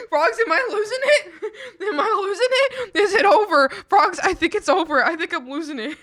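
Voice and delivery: high, loud laugh-crying; laughing sobs. Real, not acted. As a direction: laugh until it sounds like crying. Laughing breakdown at chat.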